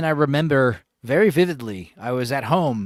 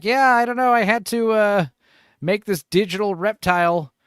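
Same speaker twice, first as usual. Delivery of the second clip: higher, louder, vague mock answer; mock unsure animator; 6 semitones higher, 4 dB louder than usual. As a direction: play her as unsure and shrugging. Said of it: "it would cut to her like".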